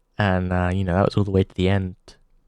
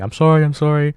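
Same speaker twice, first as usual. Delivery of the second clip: higher, louder, imitating a sobbing cashier; mock bawling apology; 7 semitones higher, 5 dB louder, faster than usual. Crying cashier apologizing to the customer, imitated bawling. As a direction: blubber out the apology. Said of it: "she's bawling and she's saying".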